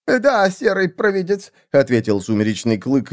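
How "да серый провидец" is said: high, nervous submission. A narrator's reading. Assassin answers the angry seer, nervous. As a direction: agree timidly in a raised voice. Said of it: "нервно сглотнув".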